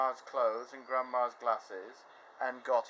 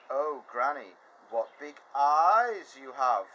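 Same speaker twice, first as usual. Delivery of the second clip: put-on louder, slow amazed girl; wondering girl; 4 semitones higher, 9 dB louder, slower than usual. Girl wondering at grandma's ears, amazed. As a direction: marvel at each feature.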